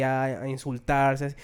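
ordinary talk

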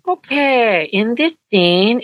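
high, slow, deliberate setup; a hint of the liaison starting her long explanation; imitating the liaison. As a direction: lightly mimic a patient director easing in. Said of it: "the Japanese liaison would say".

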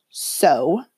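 curt whispered snap; snapped. A narrator's reading. Mrs Dursley snapping at her husband, a curt hushed retort. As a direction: bite off the word. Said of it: "snapped Missus Dursley".

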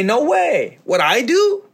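high, mock disbelief; incredulous. Speaker voicing those who refuse responsibility, high incredulity. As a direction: scoff in high-pitched disbelief.

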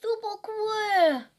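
high, slow exaggerated "Super cool"; hammed-up cheer. Real, not acted. Friend cheering along, slow high hammed-up praise.

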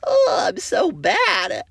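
high, slow squealed mock guilt; mock shame. To the audience after an edgy joke, high slow mock-guilty squeal. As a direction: squeal it out like you've been caught.

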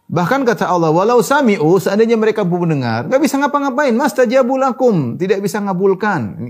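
high-pitched, forceful sermon delivery; impassioned preaching. Acted. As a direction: preach it hard, drawing out the Arabic.

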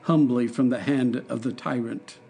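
ordinary delivery

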